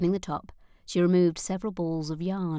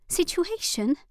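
high, disbelieving repeat; incredulous echo; 11 semitones higher, 4 dB louder, slower than usual. A narrator's reading. Nora echoes her mother's word, high and incredulous. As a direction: throw the word back in disbelief.

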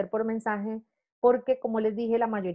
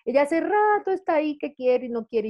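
animated aside; 6 semitones higher, 3 dB louder than usual. Real, not acted. Aside about hesitant ex-colleague.

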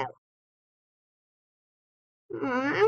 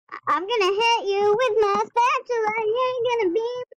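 high, loud playful threat; excited threat; 8 semitones higher, 3 dB louder than usual. Pretend. Kid threatening a game opponent with a spatula, high excited. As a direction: gleefully warn them about the spatula.

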